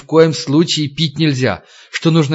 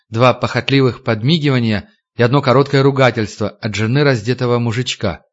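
ordinary delivery

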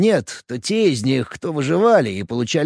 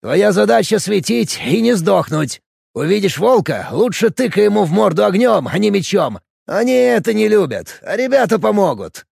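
shouted orders; 3 semitones higher, 4 dB louder than usual. Acted. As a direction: shout orders over the noise. Orders during wolf attack. (громкие приказы в бою)

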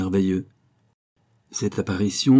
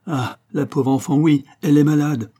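high, louder distressed confirmation; raised, aggrieved voice falling at the end; pitying lament; 6 semitones higher, 5 dB louder than usual. A narrator's reading. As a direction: lament for your poor sick child. Distressed mother confirms daughter's illness.

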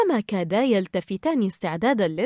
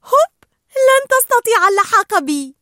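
high, loud playful teasing; giggle, then taunt in a squealing high voice; laughing; 9 dB louder than usual. A narrator's reading. Dory bouncing and daring Marlin to catch her, laughing. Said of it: "ضحكت دوري وقالت".

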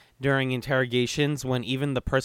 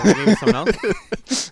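slow, high, laughing resignation; slow laughing sigh; 6 semitones higher, 8 dB louder, much slower than usual. Retold or imitated. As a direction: drawl it out giving up. Imitating Jinho giving up.

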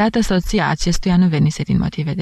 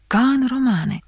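higher, bright marvelling cry; excited exclamation; 8 semitones higher, slower than usual. A narrator's reading. Won-over spectators exclaim to each other, marvelling. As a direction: exclaim it like a wonder. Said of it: "exclamau unii câștigați".

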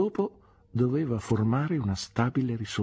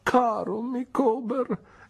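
high, slow wifely exclamation; pleading wife voice, higher; Mrs Micawber exclaiming; much slower than usual. Acted. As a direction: call her husband's name in tender alarm. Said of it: "esclamò la moglie".

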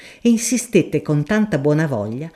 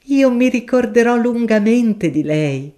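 higher, polite flustered scholar; a shy professor refusing a kindness; higher, polite, a touch flustered; mild professor; 7 semitones higher, 3 dB louder than usual. Acted. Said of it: "esclamò all'improvviso".

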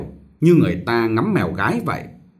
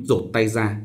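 plain reading voice

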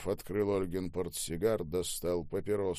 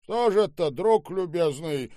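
loud, high, slowing demand; menacing reproach; 10 semitones higher, 8 dB louder than usual. Acted. Boss confronts the manager about the bottle, loud reproach. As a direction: confront him with mock courtesy.

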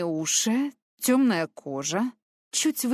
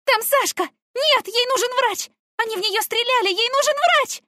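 much higher, much louder panicked yelling; frantic screaming; 6 dB louder than usual. Acted. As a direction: shriek it out, begging for a doctor. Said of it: "Я так билась и кричала".

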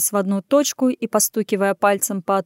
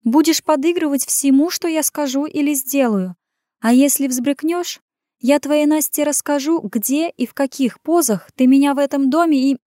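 higher woman's voice, firm blackmail; threatening warning; 6 semitones higher than usual. A narrator's reading. Woman threatening to tell his secret, tight warning. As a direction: warn him through pursed lips, pitched up. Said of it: "поджав губы, предупредила".

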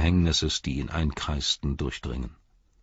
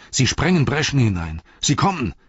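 high, louder shout of alarm; alarmed cry; 7 semitones higher, 4 dB louder than usual. A narrator's reading. Townspeople seeing the enemy break through, alarmed high shout. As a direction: cry out a warning to the town. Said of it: "riefen die Leute".

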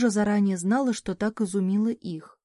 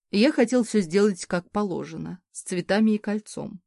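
ordinary delivery, nothing marked

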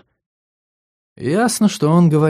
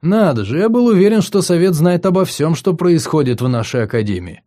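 higher, teasing feigned amazement; smug mock surprise; 6 semitones higher, 3 dB louder than usual. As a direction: needle her with false wonder. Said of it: "широко улыбнулся я".

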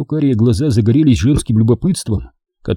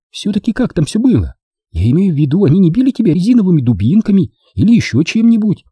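put-on higher, quick curious girlfriend; Дженни; 9 semitones higher, faster than usual. A narrator's reading. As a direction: pepper him with eager questions. Jenny voiced by narrator. (любопытная Дженни, быстро)

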